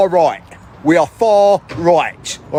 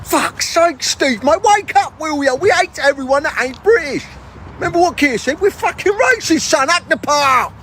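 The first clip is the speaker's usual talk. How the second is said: much higher, exasperated telling off; scolding high mate; 7 semitones higher than usual. Acted. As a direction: tell Steve off up high. High character scolds Steve.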